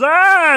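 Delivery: high loud mock dog scream; hammed-up loud dog shriek. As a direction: roar out like a fire-breathing dog.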